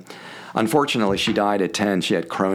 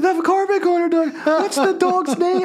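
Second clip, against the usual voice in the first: gushing woman fan; 4 dB louder than usual. Retold or imitated. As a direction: gush over the dog like a starstruck fan. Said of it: "She was like".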